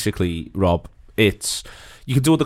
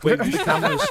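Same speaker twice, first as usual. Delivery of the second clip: laughing; faster than usual. Real, not acted. Laughing repeat of a remark.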